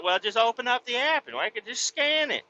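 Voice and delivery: high, eager cashier; mock cashier offering to scan. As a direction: pitch it up like the cashier. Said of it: "She's like".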